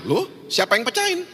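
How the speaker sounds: high-pitched puzzled query; surprised question. Retold or imitated. Father asking his son who broke it, surprised. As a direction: ask in mild mock surprise, pitched right up.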